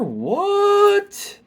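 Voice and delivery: high, loud, drawn-out what; floored by an amazing find; astonished. Real, not acted. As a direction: burst out in disbelief. Astonished at the card's ability.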